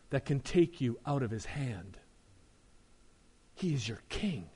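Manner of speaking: soft, low and unhurried; tender reassurance. Real, not acted. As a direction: reassure the hurting congregation gently.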